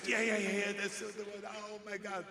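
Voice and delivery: high, loud thrilled shout; thrilled fan. Retold or imitated. Fan thrilled by the movie line, high and loud. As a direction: gush like a starstruck fan. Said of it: "the guy said".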